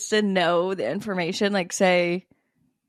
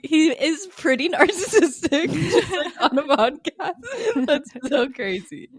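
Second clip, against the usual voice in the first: giggling higher through the words; laughing through it; 7 semitones higher, slower than usual. Real, not acted. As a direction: laugh while you get the words out. Imagining saying it on a podcast.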